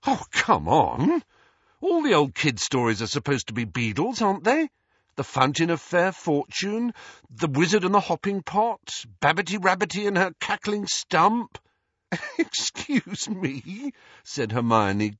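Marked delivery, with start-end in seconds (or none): 0.1-11.6 high, emphatic disbelief; disbelieving. Acted. Ron listing famous tales to his friends, incredulous. 12.1-13.9 high, drawn-out giggly query; giggling. A narrator's reading. Hermione reacting to a silly story title, giggling.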